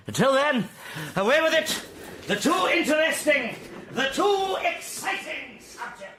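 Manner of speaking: a flourish to dismiss the subject; overexcited. Acted. Invalid brushing off the subject with a high flourish. Dexter waving the subject away.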